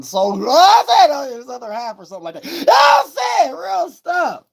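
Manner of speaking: high, fast, breaking with a cry; high crying outburst. Real, not acted. Streamer cries out to chat about his other half, high and breaking. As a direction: let the voice crack up high.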